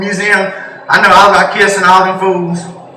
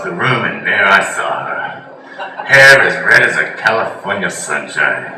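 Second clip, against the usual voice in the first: storytelling grandfather; 7 semitones lower, 4 dB quieter than usual. Retold or imitated. Grandfather telling how he met grandma, low soft nostalgic voice. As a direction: reminisce dreamily like an old man. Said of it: "He's like".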